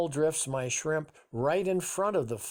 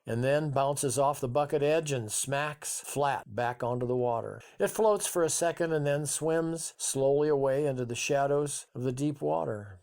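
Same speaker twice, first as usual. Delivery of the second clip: ordinary delivery, nothing marked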